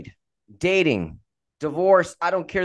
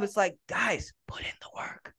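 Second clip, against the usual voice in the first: whisper; 5 semitones higher, 8 dB quieter than usual. Acted. Stage-whispered aside to listeners.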